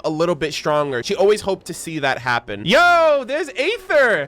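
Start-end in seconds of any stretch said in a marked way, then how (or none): 2.7-4.3 higher, louder yo, then slow; high, drawn-out excited callout; excited recognition. Real, not acted. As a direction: point him out with delight. Spots Aether on screen.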